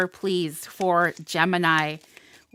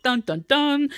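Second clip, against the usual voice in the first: higher sung-ish ominous dun dun dun; playful doom sound effect; mock dramatic dun dun dun; 6 semitones higher than usual. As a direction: hum it like a suspense sting. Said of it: "we're all like".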